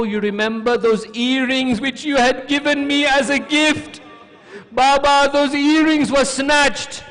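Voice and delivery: high, loud, grieving complaint; anguished child's lament. Retold or imitated. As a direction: build to a pained cry. Orphan child laments to father's head.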